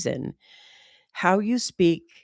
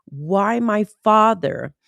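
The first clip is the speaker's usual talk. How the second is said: ordinary delivery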